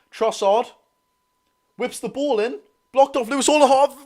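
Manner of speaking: high-pitched, rising play-by-play; excited match call. Real, not acted. Streamer calling a blocked cross, excited. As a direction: lift the voice as the chance builds.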